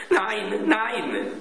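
high, drawn-out cry of refusal; boy's crying-out protest. A narrator's reading. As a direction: plead in a high child's voice. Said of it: "schrie Klaus".

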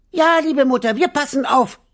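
put-on very high little-kid voices calling; little goat kids. A narrator's reading. Kids reassure their mother, high little-goat call. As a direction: pipe up high like eager little goats. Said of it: "riefen die kleinen Geißlein".

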